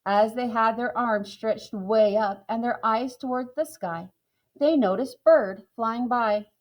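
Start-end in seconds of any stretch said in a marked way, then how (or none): none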